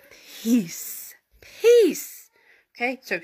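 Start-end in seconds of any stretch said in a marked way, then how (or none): 1.4-2.3 high, loud, commanding single word; teacher voice. Acted. Jesus rebuking the wind and sea, stern teacher voice. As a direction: cut through the noise like a teacher. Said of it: "that teacher voice".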